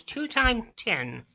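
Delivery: slow, soft dim-witted student; Rodney. Acted. Dumb student working out the principal's sum, slow and earnest. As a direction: puzzle it out very slowly, word by word. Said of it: "And Rodney goes".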